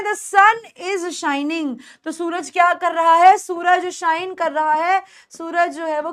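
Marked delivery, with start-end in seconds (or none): 0.0-1.8 slow model sentence. Shown as a demonstration. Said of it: "तो हम कैसे बोल सकते हैं?".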